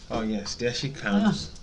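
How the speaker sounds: normal talking voice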